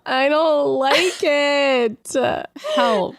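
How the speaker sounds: slow, high whining; mock distress. Real, not acted. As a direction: whimper it in mock horror. Disgusted by the quote.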